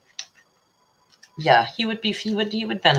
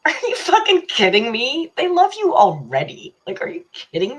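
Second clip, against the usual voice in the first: high, loud disbelief; shocked, as if he's crazy; retold loud incredulous reply; incredulous outburst; 10 semitones higher, 7 dB louder than usual. Retelling her reply to a friend.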